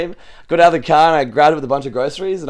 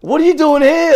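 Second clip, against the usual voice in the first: much higher, louder starstruck squeal; excited disbelief; 13 semitones higher, 5 dB louder than usual. Retold or imitated. Starstruck fan asking the streamer, high excited squeal. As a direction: blurt it out like you've met a celebrity. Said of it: "And he's like".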